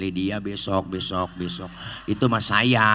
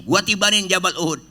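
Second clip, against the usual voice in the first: angry angel; 9 semitones higher, 5 dB louder than usual. Acted. Jibril offers to drop mountain.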